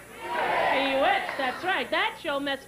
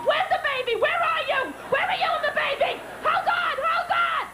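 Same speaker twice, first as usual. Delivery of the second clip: very high frantic mock shout; panicked screaming; 13 semitones higher, 3 dB louder than usual. Sister dead asleep hearing of baby, panicked shouting. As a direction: imitate someone jolted awake into panic.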